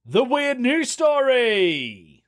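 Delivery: high, slow, hammed-up announcer voice; segment announcer. Vlogger announcing the weird news segment, slow high showman voice. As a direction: draw it out like a show intro.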